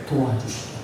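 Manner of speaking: dropped low and soft; quiet answer. Shown as a demonstration. Preacher answers the question quietly to the congregation. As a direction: say it softly, almost to yourself. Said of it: "도와주시려고 repeated".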